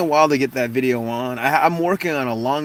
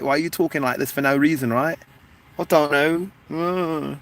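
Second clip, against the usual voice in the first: put-on UK accent; 2 semitones higher than usual. Asking why talk like this, mock UK accent. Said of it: "the U K accent".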